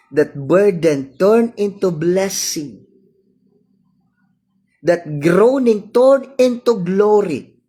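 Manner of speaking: preacher swelling to glory; rising sermon crescendo. Acted. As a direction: build the triumphant climax.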